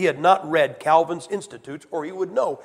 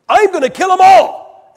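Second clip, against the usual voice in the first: much higher, full-throated comic shout; God shouting; 14 semitones higher, 15 dB louder than usual. Retold or imitated. Preacher imagines God yelling at Moses, a high comic shout. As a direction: bellow it like an exasperated God. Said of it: "I can hear God saying".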